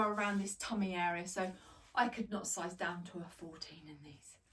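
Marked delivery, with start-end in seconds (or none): 1.9-4.4 secretive whisper; hushed confession about sizing; whispered aside. Real, not acted. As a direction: let them in on a little secret.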